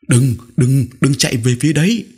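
put-on frightened child stammering out a plea; stammering in fear. A narrator's reading. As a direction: stumble over the words, begging him to stop. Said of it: "tôi lắp bắp".

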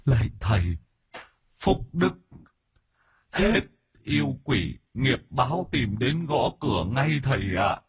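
high, halting, plaintive ghost; wronged spirit's weak, broken plea; pleading spirit. Acted. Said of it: "Chú Kích bây giờ mới bình tĩnh hơn".

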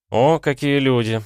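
slow, drawn-out mock greeting. A narrator's reading. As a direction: stretch the words out lazily. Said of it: "протянул я". (протяжно)

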